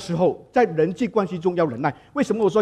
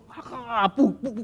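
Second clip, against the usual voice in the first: high mock wail; hammed-up toddler sobs, pitched high; imitated child crying; 7 semitones higher, much slower than usual. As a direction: act out a child bawling and refusing. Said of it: "那个孩子就大声哭".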